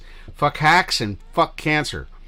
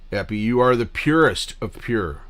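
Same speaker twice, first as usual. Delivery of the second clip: usual speaking voice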